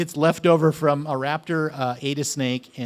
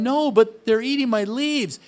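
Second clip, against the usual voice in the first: higher, louder protest; whiny complaint; 8 semitones higher, 6 dB louder than usual. Retold or imitated. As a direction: push back, upset and insistent. Client complaining, acted quarrel.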